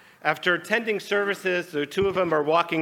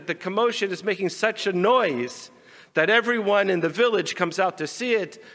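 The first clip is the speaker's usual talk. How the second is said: normal talking voice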